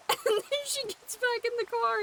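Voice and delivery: normal talking voice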